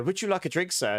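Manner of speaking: lightly mimicked posh waiter; posh service voice, retold. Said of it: "They were so posh".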